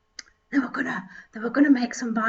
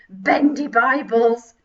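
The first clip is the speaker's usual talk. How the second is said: normal talking voice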